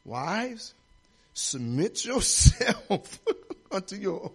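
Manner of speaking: put-on slow, laughing take-off of the old pastor's line; imitating old pastor. Recalling the pastor's teaching to wives, a slow laughing imitation. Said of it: "Pastor used to try to teach us".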